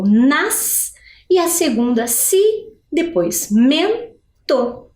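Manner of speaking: "nas", "si", "mento" each set apart; syllable by syllable. Shown as a demonstration. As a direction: isolate each syllable, "mento" slowly as men-to. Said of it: "A primeira sílaba vai ficar com". (sílaba por sílaba: "nas", "si", "mento")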